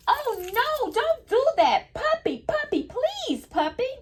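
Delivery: very high sing-song plea; storybook reading voice. Acted. Teacher reading the shaking page to kids, high sing-song plea. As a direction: beg the puppy to stop, singing it. Teacher reads book's pleading line.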